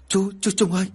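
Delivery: high-pitched acted shout of alarm; alarmed cry. A narrator's reading. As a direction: cry out to a collapsing companion. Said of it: "Anh Tâm hét toáng cả lên".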